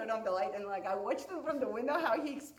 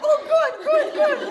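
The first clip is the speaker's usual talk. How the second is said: very high and loud, giddy; hammed-up awkward delight; squeaky nervous glee; 17 dB louder than usual. Awkward reply at the door.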